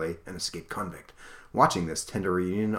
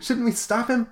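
much higher, chuckling question; laughing through the line; 13 semitones higher than usual. Acted. Aqualad asks the team, laughing through it. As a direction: break up laughing mid-line.

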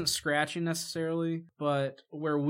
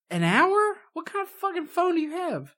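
shrill disbelieving outburst, much higher; incredulous; 4 dB louder than usual. Real, not acted. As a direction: squeal it in disbelief. Outraged about dead phone battery.

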